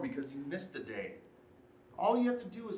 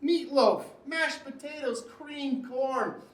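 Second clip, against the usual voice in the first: relishing; 7 semitones higher, 4 dB louder, slower than usual. Acted. Listing the real food he craves.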